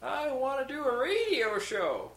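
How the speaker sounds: high, slow put-on voice; hammed-up eager newbie voice; mocking forum poster. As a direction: send up a naive wannabe radio host. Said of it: "they were talking about, uh, like".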